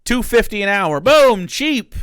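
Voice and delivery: loud, hyped-up shouting; hype shouting. Real, not acted. Hyping up offer to Prager.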